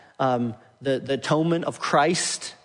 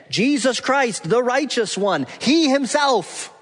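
slow, high build landing hard on "he himself"; each phrase punched high, HE HIMSELF strongest; emphatic; 8 semitones higher, 3 dB louder, slower than usual. Shown as a demonstration. Preacher showing the redundant emphasis in the verse, emphatic. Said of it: "It's redundant and emphatic".

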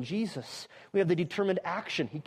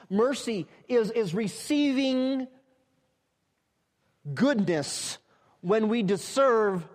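building sermon emphasis; 4 semitones higher, 5 dB louder, slower than usual. Real, not acted. Building definition of mercy.

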